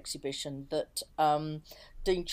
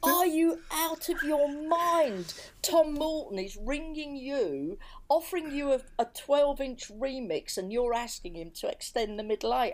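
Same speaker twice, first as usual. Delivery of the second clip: incredulous husband; 8 semitones higher than usual. Retold or imitated. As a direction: spell out how mad the request is, aghast. Husband scolding her request, voiced.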